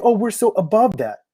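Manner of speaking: mimicking the left, mock smug; mocking smugness. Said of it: "Why are you saying".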